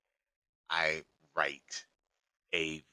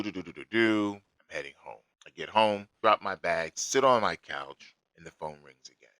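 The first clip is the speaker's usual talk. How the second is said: ordinary delivery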